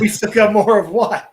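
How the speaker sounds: loud, jokey indignant question; hammed-up shocked demand; mock outrage. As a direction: play up the disbelief.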